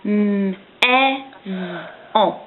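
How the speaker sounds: each sound said apart; segmented sounds. Shown as a demonstration. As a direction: sound out slowly, one by one. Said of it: "je vais te dire les sons d'un mot".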